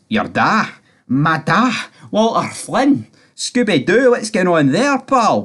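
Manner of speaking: put-on high-pitched send-up of a funny Scottish accent; mock Scottish attempt. Mocking the daughter calling him Da, funny accent attempt. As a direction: parody a weird, failed Scottish accent. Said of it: "a funny attempt at, like, Da".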